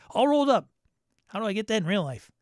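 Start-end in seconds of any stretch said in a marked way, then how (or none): 0.1-0.7 higher and louder, hammed-up; mock-excited. Mocking the chill beach adventure title, hammed-up excitement. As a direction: belt the title out like a cheer.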